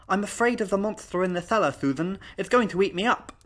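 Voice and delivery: put-on high little-girl voice, lisping hard; exaggerated childish lisp. Little girl tells governess she fears a monster, exaggerated lisp. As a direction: lay on a cute lisp as a child seeking attention. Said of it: "that exaggerated lisping".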